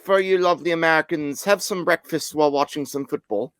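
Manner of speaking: teasing the Americans in British; British accent. Pretend. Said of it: "doing my British accent".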